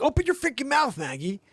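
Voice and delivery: loud high frantic command; frantic yell. Retold or imitated. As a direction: shout it at the dog in panic. Dad ordering dog to open mouth.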